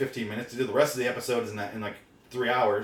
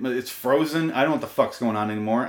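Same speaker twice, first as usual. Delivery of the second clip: ordinary talk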